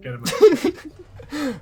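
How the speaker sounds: high, loud laughing yell; laughing shout. Real, not acted. Mock outrage, laughing.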